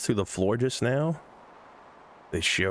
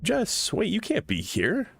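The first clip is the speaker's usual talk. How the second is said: high, playful mock-scold; 12 semitones higher than usual. Real, not acted. Streamer scolding Jess for appearing on the stream, high and playful. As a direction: tease her for breaking a promise.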